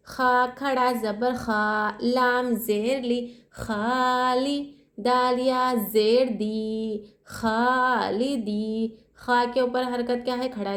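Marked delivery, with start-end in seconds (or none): 0.1-9.0 very slow, खा and दी each held one alif length; teacher chanting the letters of खालिदी; stretched tajweed spelling. Shown as a demonstration. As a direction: draw out खालिदी syllable by syllable. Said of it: "एक अलिफ की मकदार खींचेंगे".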